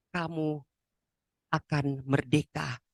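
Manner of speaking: usual speaking voice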